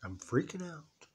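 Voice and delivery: a small scared confession; soft admission. Shown as a demonstration. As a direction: let the fear out quietly. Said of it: "Say it".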